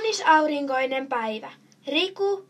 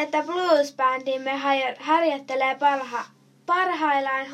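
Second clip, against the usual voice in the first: ordinary delivery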